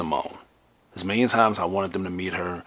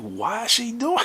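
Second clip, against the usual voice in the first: high, drawn-out mock bewilderment; dismayed exclamation. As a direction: wail it up high, slowly, in disbelief. Retold dismay at her behaviour.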